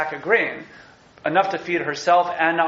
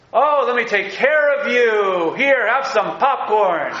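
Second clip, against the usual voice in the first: higher, louder, slow hammed-up gallant host; mock-generous Boaz; 6 semitones higher, 4 dB louder, slower than usual. Teacher mocking Boaz offering Ruth popcorn, hammed-up generous voice. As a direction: ham up a man proudly offering popcorn. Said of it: "Boaz is like".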